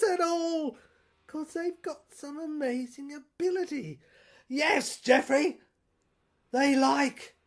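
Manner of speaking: high, loud, unhinged tycoon rant; a shrill, loud madman's tirade; ranting villain. Acted. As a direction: rave like a cornered mogul losing his grip. Raving villain monologue.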